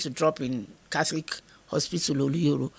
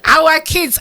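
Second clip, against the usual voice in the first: retold line through loud laughter; wife laughing at her own forgetfulness; 12 semitones higher, 13 dB louder than usual. Real, not acted. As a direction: laugh while quoting him. Husband incredulous, speaker laughing.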